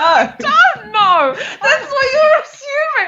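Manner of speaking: high, squealed denial; exasperated, half-laughing outburst. Real, not acted. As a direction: protest at full pitch amid laughter. Protesting amid laughter at accusation.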